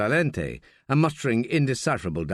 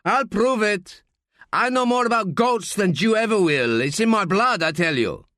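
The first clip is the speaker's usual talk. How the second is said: loud, higher, blustering boast; shouting; 8 semitones higher, 3 dB louder than usual. Acted. Gutierrez shouting at the bar to prove himself, loud and higher. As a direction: bang the bar and shout him down.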